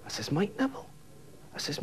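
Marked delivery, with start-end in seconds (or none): 0.3-0.9 "Mike Neville" shooting up high as a question; incredulous echo. Retold or imitated. Teller questions colleague's reason, a high incredulous "Mike Neville". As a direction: query "Mike Neville" in high-pitched disbelief. Teller echoes the name in disbelief.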